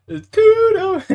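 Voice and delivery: It's Kudo in a very high squeak; squeaky mock voice. Mocks the name in high voice.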